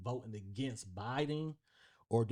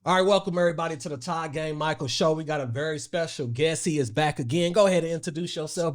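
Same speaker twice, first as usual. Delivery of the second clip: loud, higher, brisk welcome; show host intro; 6 semitones higher, 10 dB louder than usual. Real, not acted. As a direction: kick off the show, projecting big.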